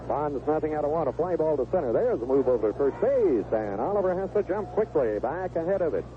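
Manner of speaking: ordinary talk, nothing marked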